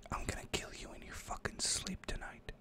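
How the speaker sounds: menacing whispered threat; creepy whisper. Retold or imitated. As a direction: breathe the threat right into his ear. Retelling the kid's threat.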